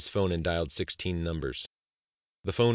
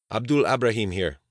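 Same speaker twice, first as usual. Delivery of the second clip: put-on higher, louder Arabic-accented phone greeting; thick-tongued Arabic accent; 4 semitones higher, 5 dB louder than usual. A narrator's reading. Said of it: "in his thick-tongued Arabic accent".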